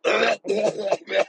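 high, loud imitated hacking cough; mock hacking cough. Imitating the plane passenger hacking up a lung. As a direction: hack it up like a sick plane passenger. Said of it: "She was like".

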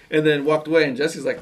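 normal talking voice

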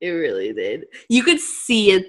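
ordinary talk

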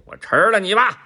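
猛然高声大喊; 吓唬孩子般一声大吼; 老虎扑食吼叫. Acted. 老虎扑向驴. (老虎突然大声扑向驴)

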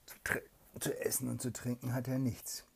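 "zu essen und zu trinken" in a hushed whisper; whisper narration. A narrator's reading. Mother reads to her child, hushed whisper.

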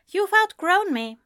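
high, simple statement of hurt; frank, resigned admission; said plainly. A narrator's reading. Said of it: "he said plainly".

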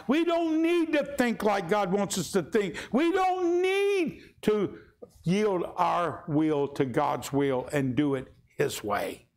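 preaching at full force; mock stubborn voice. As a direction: voice the proud heart crying out. Preacher mocking stubborn believers.

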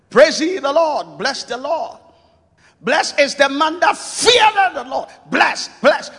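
loud, high, ringing scripture shout; fervent proclamation. Real, not acted. Preacher shouting the psalm to the congregation. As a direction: lift the verse up and shout it.

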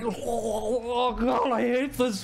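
high, drawn-out groaning complaint; miserable whine. Acted. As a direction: moan it while forced to drink. Vendor forced to drink booze.

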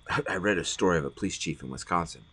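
usual speaking voice

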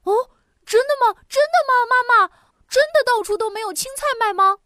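high, fast eager questions; can't believe the good news; excited child. A narrator's reading. Child eagerly asks mother. (孩子兴奋地追问)

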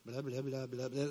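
low, soft mindless gabble; mock rote recital. Imitating coworkers reciting a quality policy, mock gabble. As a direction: rattle it off as meaningless babble. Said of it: "where people just, like".

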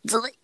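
defibrillator zap noise; quick zap sound effect; mouth zap sound. Acted. Imitating defibrillator.

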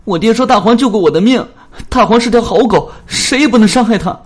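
high, loud, fast tearful yell; desperate child's cry, shouting. A narrator's reading. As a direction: scream it through tears to save the dog. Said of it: "一下子就急哭了，大声喊起来".

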